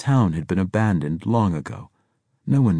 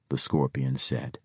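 plain reading voice

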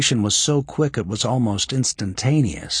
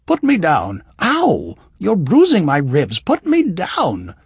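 protesting; 6 semitones higher, 6 dB louder than usual. A narrator's reading. Being swung around wildly.

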